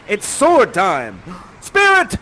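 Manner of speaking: loud, slow and grand; heroic challenge. Acted. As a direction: call him out to fight. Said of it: "He says".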